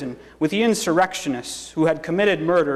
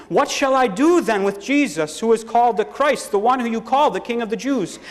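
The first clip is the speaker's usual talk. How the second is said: higher-pitched, insistent appeal; 7 semitones higher than usual. A narrator's reading. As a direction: appeal to a hostile crowd. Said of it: "Pilate appealed to them again".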